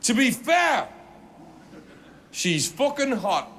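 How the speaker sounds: mock the Geordie bloke pointing and shouting; angry Geordie heckler. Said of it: "really angry like this".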